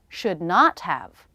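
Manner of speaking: should not have said slowly; careful full form before reducing. Shown as a demonstration. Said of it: "Let's learn the negative too".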